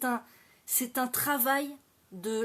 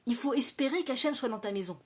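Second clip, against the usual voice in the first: ordinary talk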